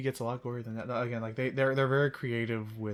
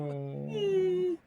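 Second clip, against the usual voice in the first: high silly voice. Pretend. Voicing the game character.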